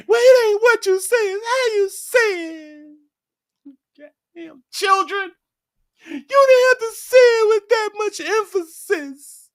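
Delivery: very high, loud scolding woman's voice; mock offended woman. Wife scolding her man over how he said it, shrill and loud.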